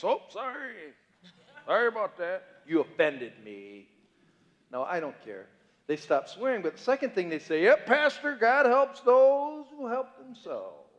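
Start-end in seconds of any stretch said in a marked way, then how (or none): 0.0-3.9 mock apology. People apologise for swearing to pastor. 7.6-10.9 higher, slow, self-assured proverb; mock earnest quote. People quoting proverb to pastor.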